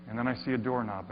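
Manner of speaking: ordinary talk, nothing marked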